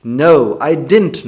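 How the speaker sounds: loud, high No I didn't; heated denial. Shown as a demonstration. Teacher repeats the shouted denial. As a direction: fire the denial back. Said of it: "वो जो did not हमारा होता था".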